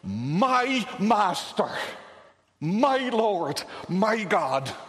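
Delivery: loud, drawn-out address to God; emphatic, ringing devotion; forceful cry. Acted. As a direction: proclaim each title with weight. Preacher acting the psalmist's cry.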